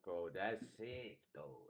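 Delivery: ordinary delivery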